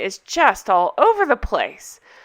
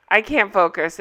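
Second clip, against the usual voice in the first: normal talking voice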